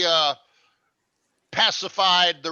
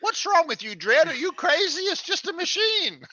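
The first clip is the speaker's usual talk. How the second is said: Hershey; 11 semitones higher, faster than usual. Retold or imitated. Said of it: "and Hershey's going".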